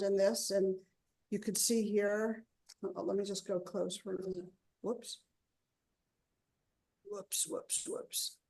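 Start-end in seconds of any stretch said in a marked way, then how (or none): 4.8-8.4 startled, soft and rising high; little alarmed oops under breath. Real, not acted. Camera slips while moving close.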